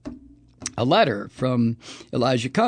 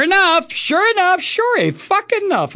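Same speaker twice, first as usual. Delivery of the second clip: sure enough rising higher and louder; building exasperation; 6 dB louder than usual. Real, not acted. Host building outrage at the spokeswoman, rising. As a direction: climb each repeat in disbelief.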